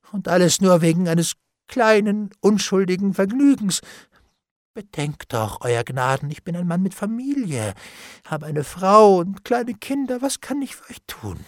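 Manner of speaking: plaintive plea climbing in pitch; desperate pleading. A narrator's reading. Bailiff pleads his wife and children, desperate. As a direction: beg with rising, wailing insistence.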